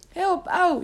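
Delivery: high-pitched pained yelp for help; wailing. A narrator's reading. Caught servant to the knight gripping him, wailing. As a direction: wail like a servant being grabbed. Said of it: "jammerde de knecht".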